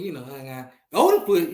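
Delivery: normal talking voice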